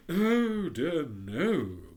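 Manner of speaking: hint of a haughty rocket; lightly voiced pompous Rocket. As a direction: read it as a vain firework showing off.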